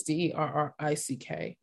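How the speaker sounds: letter by letter, D E R R I C K, softly; spelling it out. Shown as a demonstration. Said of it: "his first name is spelled".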